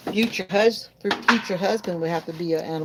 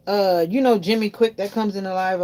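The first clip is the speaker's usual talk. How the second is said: normal talking voice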